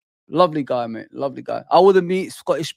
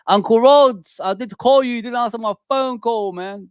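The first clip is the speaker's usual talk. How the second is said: high, loud teasing call; excited call-out; 7 semitones higher, 5 dB louder than usual. Real, not acted. Streamer teasing Uncle Rhodes for not answering, loud and high. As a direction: call out your mate for ignoring you. Calling out friend who didn't answer.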